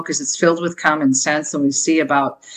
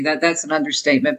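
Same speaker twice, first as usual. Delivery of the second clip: usual speaking voice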